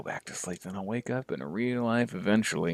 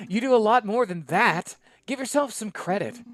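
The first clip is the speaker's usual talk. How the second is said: higher voice, fond then reproachful; scolding young doctor; 7 semitones higher than usual. Acted. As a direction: tease him, then tell him off. Izzy voice continues.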